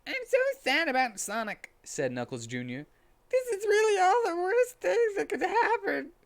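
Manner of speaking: very high, loud whiny child voice with a fake cry; bawling little kid, shrill; hammed-up crying child; Knuckles Junior.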